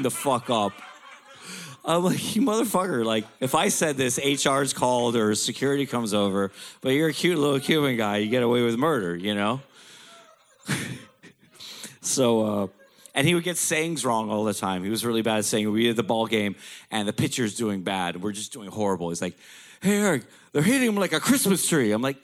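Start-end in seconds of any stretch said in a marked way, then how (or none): 2.4-3.0 high, amused indignant jab; mock outrage. 19.8-21.9 put-on high, louder old dad voice; imitating dad. Dad voiced saying it wrong.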